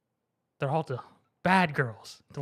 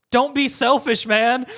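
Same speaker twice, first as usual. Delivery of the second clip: high, loud mock plea; hammed-up loud reproach; pleading outburst; 8 semitones higher, 10 dB louder, slower than usual. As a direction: burst out begging a friend. Acted buddies pleading with friend.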